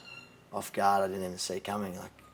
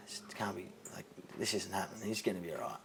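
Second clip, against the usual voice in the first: softer, whispering to himself; whispered disbelief; 10 dB quieter than usual. Retold or imitated. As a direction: whisper it like you can't believe it. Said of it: "I'm like".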